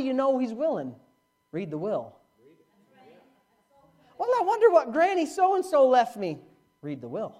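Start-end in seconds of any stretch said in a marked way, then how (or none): 4.2-6.4 high, dreamy idle musing; high mock wondering. Preacher mocking someone who won't read the will, high dreamy musing. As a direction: muse like a clueless heir.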